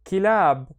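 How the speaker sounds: KILAB loud, run together as one word; syllables joined. Shown as a demonstration. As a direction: connect ki and lab into a firm "kilab". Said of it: "and then connect them".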